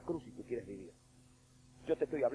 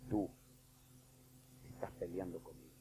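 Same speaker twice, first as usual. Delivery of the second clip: much softer, near-whispered lament; hushed; 5 semitones lower, 10 dB quieter than usual. Acted. Preacher quietly voicing Jesus to a faithful servant. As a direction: pull the room in close and grieve.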